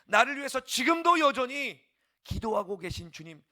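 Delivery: loud, high preaching climax, rising fervour; full-force preaching. Acted. Pastor crying out to the church to think only of the Lord, full-force preaching. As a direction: shout it out to the congregation like a revival preacher.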